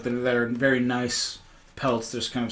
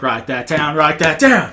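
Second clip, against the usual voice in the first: excited shout, laughing; mock urgent order; 3 semitones higher, 9 dB louder than usual. Friend wanting the silly name noted, mock urgent. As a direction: call it out louder, building to a yell.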